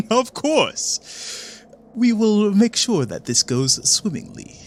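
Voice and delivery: high, sly put-on voice; the first cultist agreeing smugly, voice pitched high; smug villain. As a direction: purr it like a pleased schemer. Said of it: "you hear the other voice go".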